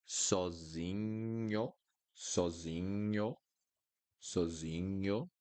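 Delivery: sozinho drawn out slowly, nh like ñ; Portuguese nh. Shown as a demonstration. Teacher drills sozinho slowly for learners, nh like ñ. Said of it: "N H will always sound like niño".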